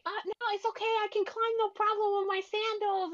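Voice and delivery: put-on very high, carefree kid voice; imitating young son. Son to parents about climbing, breezy high kid voice. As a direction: play the child brushing off the warning. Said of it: "And he's like".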